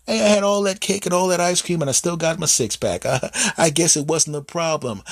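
high, smug mirror voice; pleased with himself in the mirror; smug self-talk. Acted.

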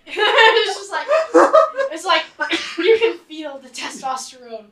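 talking through laughter; laughing. Real, not acted. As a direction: laugh while you say it.

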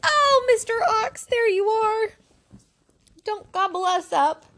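high, pleading cry. Acted. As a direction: plead with the hungry coyote. Characters plead with the coyote.

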